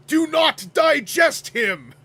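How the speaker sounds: high booming villain shout; commanding cartoon villain. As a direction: command it like a toy commercial baddie. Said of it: "And also at one point says".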